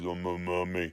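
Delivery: a low, soft hint of a mummy moan; lightly voiced mummy. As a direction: groan it faintly like a mummy. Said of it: "I'm still doing the voice".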